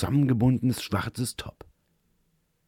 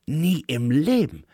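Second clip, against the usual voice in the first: slow, higher, a hint of breathlessness; mild panting; 5 semitones higher than usual. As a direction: gasp it out while holding on. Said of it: "keuchte sie".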